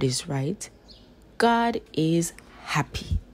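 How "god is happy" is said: ordinary delivery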